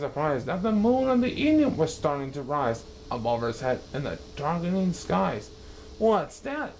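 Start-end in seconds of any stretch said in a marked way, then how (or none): 6.0-6.7 high, indignant snort; snorted question. Acted. Yertle seeing the moon, high indignant question. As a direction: scoff at the rising moon. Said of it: "snorted Yertle".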